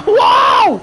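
loud high whoa, jolted; startled whoa. Real, not acted. Sudden on-stream clash.

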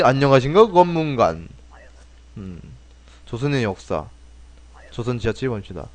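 ordinary delivery

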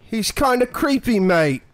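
Cockney James Sunderland. Acted. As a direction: do a bad Cockney bloke. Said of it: "let's go with more Cockney James Sunderland".

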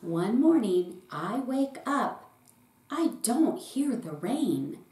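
ordinary delivery, nothing marked

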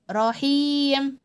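رحيم with a held vowel; long ii in رحيم. Shown as a demonstration. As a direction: stretch the ii of رحيم. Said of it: "المد بالياء حركة طويلة".

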